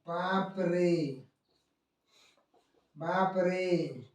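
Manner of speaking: बाप रे slow and soft, the vowels stretched; prolonged vowel demo. Shown as a demonstration. Teacher showing students a pluta vowel, slow stretched बाप रे. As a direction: draw out बाप रे as if calling in dismay. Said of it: "ऐसे बढ़ते चला जाता है".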